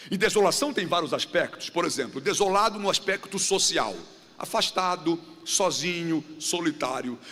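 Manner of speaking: ordinary talk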